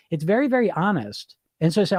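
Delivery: usual speaking voice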